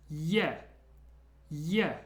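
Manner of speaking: "ye" with a y glide into an open e; Y before E. Shown as a demonstration. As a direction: say "ye" as in the start of "yes". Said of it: "a little secret Y just before it".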